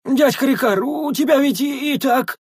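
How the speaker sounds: very high plaintive voice; whining protest. Acted. Young sentry objecting to uncle, whining high. Sentry whines down at big man.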